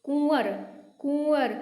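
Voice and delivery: कुंवर twice with a nasal u; कुंवर repeated with a nasal vowel. Shown as a demonstration. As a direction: repeat कुंवर through the nose. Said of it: "वापस से बोल के देना".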